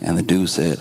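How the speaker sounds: ordinary talk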